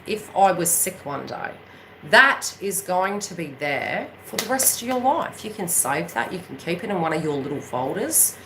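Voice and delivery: ordinary delivery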